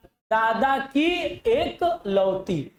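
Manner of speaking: very slow, spelling out दादा की एकलौती; slow stressed repeat. Shown as a demonstration. Teacher slowly repeating the clue for students. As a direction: drag out दादा की एकलौती word by word. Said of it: "किसकी बाबू?".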